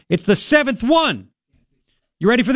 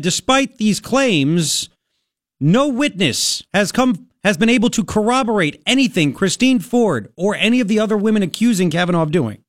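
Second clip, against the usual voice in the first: mock reporter line. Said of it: "The reporter could have got in there and said".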